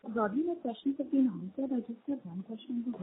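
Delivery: very soft, far from the mic; faint, low-volume voice; barely audible. Real, not acted. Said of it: "your voice is very low".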